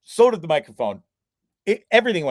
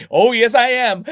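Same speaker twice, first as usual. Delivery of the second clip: mock loud retort; 7 semitones higher, 8 dB louder than usual. Retorts while unseen on call.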